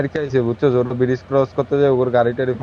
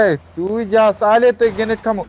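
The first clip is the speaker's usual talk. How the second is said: higher, louder urgent shouting; urgent excited callout; 6 semitones higher, 4 dB louder than usual. Real, not acted. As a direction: yell the orders in the heat of battle. Firefight, urgent callouts to teammate.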